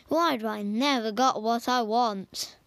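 ordinary delivery, nothing marked

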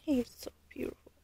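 breathy whisper of awe. Real, not acted. Leaning over the sleeping baby, a hushed whisper.

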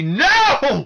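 high, loud yelled "No!"; retold scream at the TV. Fan yelling at his TV during the match, loud high "No!". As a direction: shout it like watching your guy lose. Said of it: "I was screaming at my T V".

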